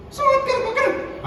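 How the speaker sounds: a mock listener piping up; high squeaky interjection.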